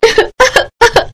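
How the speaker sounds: high, hammed-up crying sobs; mock sobbing. Wife wailing for food.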